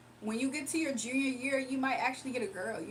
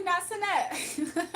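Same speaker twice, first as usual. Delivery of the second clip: laughing; 7 semitones higher, 4 dB louder, much slower than usual. Real, not acted. Reading chat, bursting laughing.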